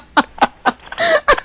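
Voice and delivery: very high-pitched laugh; burst of laughter. Real, not acted. Comic finishing comeback about the auction bid, high laughter. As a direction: crack up at your own punchline.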